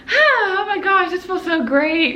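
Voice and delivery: high gasp, then gushing relief; hopeful delight. Retold or imitated. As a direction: act out delighted anticipation. Hopeful inner thoughts acted out.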